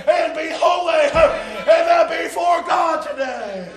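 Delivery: loud revival sermon shouted in rhythmic bursts; impassioned preacher in full cry, punctuated by amens; full-force preaching. Real, not acted. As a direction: drive every phrase home at shouting volume.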